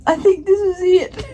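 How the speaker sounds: high, voice wobbling with tears; on the verge of crying; tearful. Real, not acted. Vlogger admitting this is her good cry, tearful. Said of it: "if I do cry".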